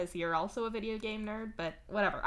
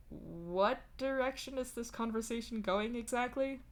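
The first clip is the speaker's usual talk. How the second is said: higher, wary questioning; slightly tense; 5 semitones higher than usual. A narrator's reading. Dave wary of where this is going, slightly tense. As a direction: ask it with a touch of tension. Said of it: "in a slightly tense voice".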